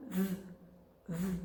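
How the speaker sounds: "v, v" as an isolated voiced TH buzz; TH sound. Shown as a demonstration. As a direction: hold a voiced TH for "v, v", tongue tip on front teeth. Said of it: "você consegue esse som".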